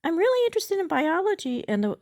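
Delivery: high, loud bot character; chatbot voice. Acted. Chatbot sidetracks user's question into biology, high bot voice. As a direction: pitch it right up as the bot.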